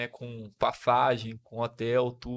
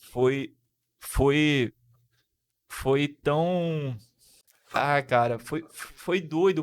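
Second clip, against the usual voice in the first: choked up; 4 dB louder, much slower than usual. Real, not acted.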